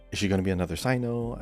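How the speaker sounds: ordinary delivery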